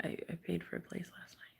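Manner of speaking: hushed, quick whispered excuse; whispered and rushed. Retold or imitated. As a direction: mumble it under your breath, fast. Quoting the intruder's excuse.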